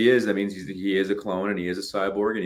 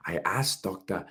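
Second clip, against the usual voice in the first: Herzog narration; 5 dB quieter than usual. Retold or imitated. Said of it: "the narration is Werner Herzog going".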